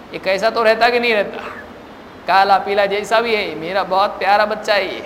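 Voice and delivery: ordinary delivery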